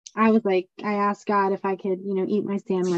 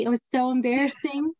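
normal talking voice

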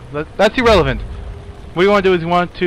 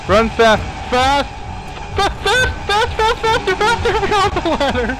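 panicked squeal. Real, not acted. Fleeing, squealing faster, faster.

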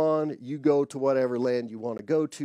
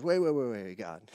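urgent stopping; 4 semitones lower than usual. Retold or imitated. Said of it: "Moses backs up and he says".

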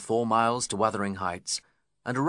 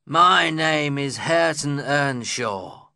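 slow, loud, raised growl; 5 semitones higher, 6 dB louder, slower than usual. A narrator's reading. The young man correcting the guest, growling. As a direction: grind out the name resentfully. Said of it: "growled the young man".

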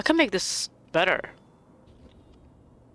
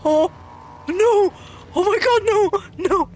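horrified dismay; 7 semitones higher, slower than usual. Real, not acted. Player horrified by what the game shows, wailing dismay. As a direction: cry out in high distress.

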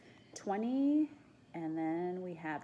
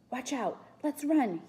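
raised, urgent warning; sharp urgent warning; 6 semitones higher, 4 dB louder than usual. A narrator's reading. Boy warning friends to flee, sharp and urgent. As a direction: get them moving, pitched up rather than whispered. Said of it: "he whispered sharply".

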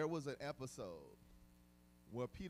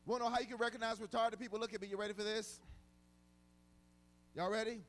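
preaching at full force; 7 semitones higher, 9 dB louder than usual. Acted. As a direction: drive the point home to the congregation. Preaching to congregation again.

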